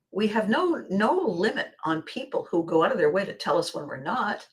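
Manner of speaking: usual speaking voice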